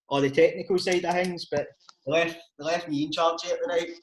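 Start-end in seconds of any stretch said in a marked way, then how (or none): none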